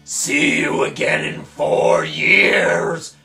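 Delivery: very slow, drawn-out sinister call; creepy farewell. Retold or imitated. Clerk leaning round the corner at him, slow sinister call. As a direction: drag it out like a horror villain. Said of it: "she's like".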